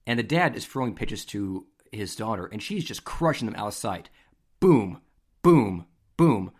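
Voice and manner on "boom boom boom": loud punchy booms, each falling; booming hit sounds. Retold or imitated. Sportscaster describing a batter crushing pitches, loud booms.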